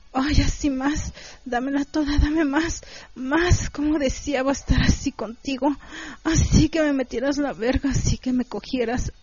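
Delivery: high, pleading moans; acted pleasure, pitched high; moaning plea.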